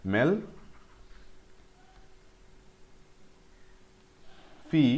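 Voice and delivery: मेल फी spelled out slowly, one syllable at a time; careful teaching split of मेल फी. Shown as a demonstration. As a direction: break it down: मेल, then फी. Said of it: "मेल फी यहाँ तक pronounce करो".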